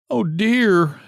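high, crying groan of despair. Acted. Margaret cries alone at night, mild crying groan. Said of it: "she groaned".

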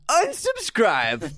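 high, very slow unsubscribe; mock unsubscribe. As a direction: draw it out high like a threat in jest. Punchline of reading comments.

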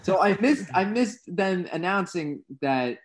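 lingering annoyance. Real, not acted. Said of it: "I was very pissed".

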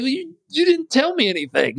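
high exasperated retort. Retold or imitated. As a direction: throw up your hands in disbelief. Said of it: "And I was like".

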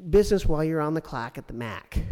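high mocking prissy imitation; high sneering parody of the council lady; mocking Karen. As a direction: mimic her in a high, snooty voice. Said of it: "she said".

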